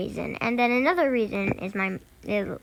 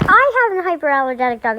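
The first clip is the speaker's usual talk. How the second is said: higher, louder sing-song repeat of "I have a hyperallergenic dog"; mocking echo; 5 semitones higher, 7 dB louder, faster than usual. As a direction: parrot her words back, teasing and fast. Kid mockingly echoes the babysitter.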